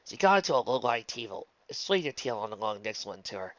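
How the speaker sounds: ordinary delivery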